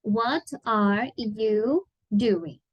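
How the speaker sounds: very slow, word-by-word "What are you doing?"; careful, spaced-out "What are you doing?"; slow example reading. Shown as a demonstration. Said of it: "Vou colocar aqui um exemplo".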